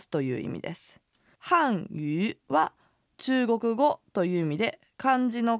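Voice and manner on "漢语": 漢语 said very slowly; slow careful 漢语; slow demo word. Shown as a demonstration. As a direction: stretch out 漢语 for learners. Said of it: "語の発音は".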